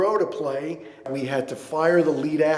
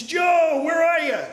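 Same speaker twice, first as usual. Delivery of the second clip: raised, high-pitched mock shout; retold excited greeting; 5 dB louder, slower than usual. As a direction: call it out loud and high, as retold from a phone call. Retold delighted phone greeting.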